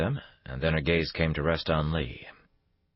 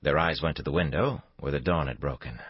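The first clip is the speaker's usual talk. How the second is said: ordinary delivery, nothing marked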